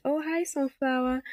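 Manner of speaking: high sweet greeting to a flower; cooing. Retold or imitated. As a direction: coo at it like a puppy. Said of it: "as though I'm petting a dog's head".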